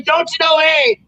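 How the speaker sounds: high mock Canadian "Don't you know, eh?"; mock Canadian accent. Mocking the campers' Canadian phrase mid-rant, mock Canadian accent. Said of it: "If I hear another person say".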